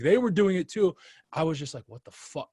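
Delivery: ordinary talk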